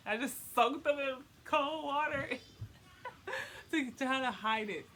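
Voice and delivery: amused telling broken up by real laughs; laughing. Real, not acted. Woman confessing how she tried to hide it, laughing. Laughing while retelling embarrassing story.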